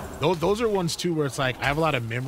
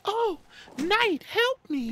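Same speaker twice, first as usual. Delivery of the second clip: high, breathy damsel in distress; airy princess voice; slower than usual. Retold or imitated. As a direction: play the helpless princess, all air. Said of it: "has the really, like, airy voice".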